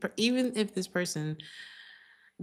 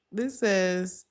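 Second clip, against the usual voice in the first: ordinary talk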